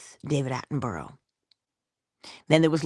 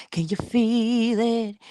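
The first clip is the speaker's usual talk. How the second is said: higher, slower hyped-up repeat; rising hype; 6 semitones higher than usual. Shown as a demonstration. Hyping up a lazy listener, rising excitement. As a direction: push it higher the second time. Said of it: "hyping listener up, higher take".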